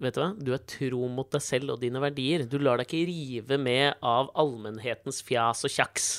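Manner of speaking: a man praising his own reflection, softly and smugly; mock self-praise. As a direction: nod at yourself in the mirror, quietly proud. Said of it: "nikke til oss selv og si".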